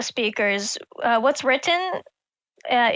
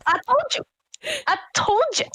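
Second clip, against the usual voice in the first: excited gloating; 8 semitones higher than usual. Real, not acted. Gloating after being right.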